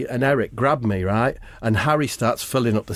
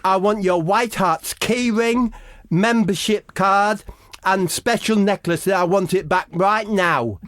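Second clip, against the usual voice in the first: mock upset; 8 semitones higher than usual. Mock upset kid voice, story.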